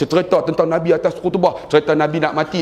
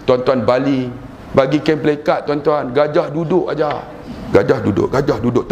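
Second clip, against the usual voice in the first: ordinary delivery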